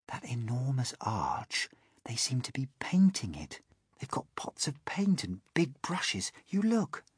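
soft, secretive whisper; hushed, breath-only report. A narrator's reading. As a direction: whisper the discovery in wonder. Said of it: "James whispered".

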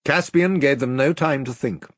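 plain reading voice

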